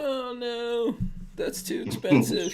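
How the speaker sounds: high, soft teasing imitation dropping to a near-whisper; mock reluctance. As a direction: imitate a cheapskate balking, softly and mockingly. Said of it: "You're like".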